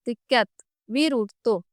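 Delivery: plain reading voice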